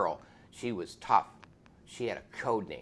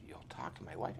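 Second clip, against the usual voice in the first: whispered disbelief; 7 semitones lower, 10 dB quieter, faster than usual. Retold or imitated. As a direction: lower and softer, hushed surprise. Biker's astonished quiet question.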